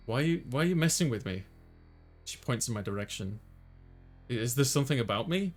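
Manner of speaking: ordinary talk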